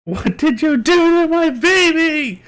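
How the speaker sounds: high, slow screeching goblin. Acted. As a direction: shriek it like an angry goblin. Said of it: "In a goblin voice".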